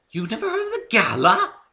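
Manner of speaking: very high, loud shocked gasp of a question; be appalled he never heard; scandalized. Acted. Rarity shocked at the narrator, scandalized.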